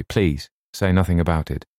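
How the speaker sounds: plain reading voice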